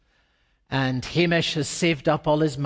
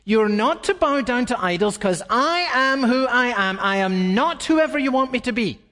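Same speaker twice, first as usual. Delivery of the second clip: higher, emphatic divine declaration; voicing God firmly; 7 semitones higher than usual. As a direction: proclaim it as God would. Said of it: "It's what God literally said".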